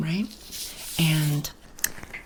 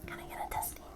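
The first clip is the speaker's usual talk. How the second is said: soft ASMR whisper into mic; right in your ear; 16 dB quieter, faster than usual. Real, not acted. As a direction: whisper a mic test close up. ASMR mic test, far whisper.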